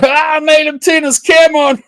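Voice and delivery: high, loud gloating mock voice; mimicking the other guy's gloating shout; mocking imitation. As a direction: crow it like a smug gloater, laughing. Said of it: "so you can go".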